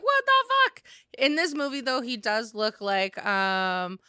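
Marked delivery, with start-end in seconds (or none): 0.0-0.8 high, loud shocked outburst. Retold or imitated. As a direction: yelp it out in disbelief. Shocked reaction to movie.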